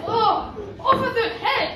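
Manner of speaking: loud, high royal command; shouted order. A narrator's reading. Prince condemning the ugly sister, shouting the order. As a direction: bellow it as an execution order.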